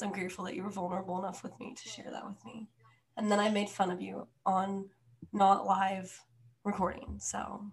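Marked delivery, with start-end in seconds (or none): 0.0-2.7 sincere whisper. Real, not acted. Sincere thanks after emotional share.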